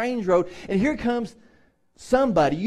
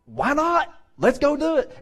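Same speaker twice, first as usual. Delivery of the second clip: high, louder eager reply; eager agreement; 8 semitones higher, 4 dB louder than usual. Acted. Philip agrees to baptize the man, eager. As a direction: jump at the idea, pitched up and keen. Philip answers the excited convert.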